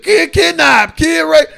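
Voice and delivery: high, loud mock-alarm shout; sarcastic high shouted headlines. Mocking mothers' news fears.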